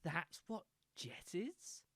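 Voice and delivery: very soft, near-whispered disbelief; stunned, hushed. Real, not acted. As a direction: breathe it out quietly, stunned. Stunned reaction to game revelation.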